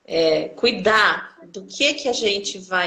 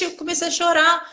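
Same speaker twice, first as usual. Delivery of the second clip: normal talking voice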